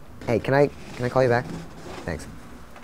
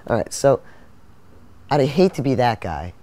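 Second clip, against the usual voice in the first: ordinary talk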